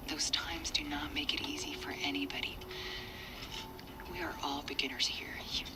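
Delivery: low, hushed, patient reassurance; gentle consoling adult. Acted. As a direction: soothe and explain softly to a hurt child. Adult consoles child, explaining adults' failings.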